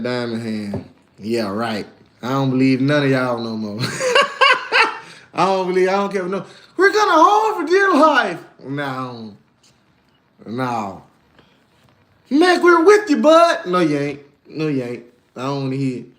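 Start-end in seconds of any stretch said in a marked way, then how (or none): 3.8-5.0 sudden high-pitched laugh; high squeal laugh. Real, not acted. Laughing at chat comments, high squeal laugh. 6.8-8.4 high, loud mocking voice of the viewers; mocking chat voice. Mimicking viewers who claim they'll hold, silly high voice. 12.3-13.7 high, loud whiny fake supporter; mocking chat voice. Mimicking viewers who say they're with him, high mock voice.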